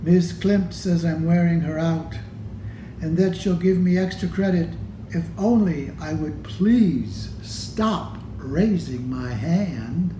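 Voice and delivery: plain reading voice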